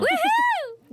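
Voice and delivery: high, bright whoop; excited cheer. Real, not acted. Cheering a new name.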